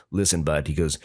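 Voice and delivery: low-pitched imitation of a lecturing officer; mock low authority voice; stern cop. Retells cop's stern parting advice.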